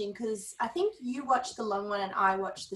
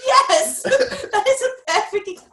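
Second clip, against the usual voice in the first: laughing; 12 semitones higher, 9 dB louder than usual. Real, not acted. Laughing at a joke.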